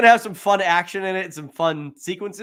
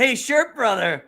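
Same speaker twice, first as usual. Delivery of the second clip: slow, higher mock old-man voice; old guy's line; 6 semitones higher, 3 dB louder, slower than usual. Quoting the old bum to his buddy, slow and high.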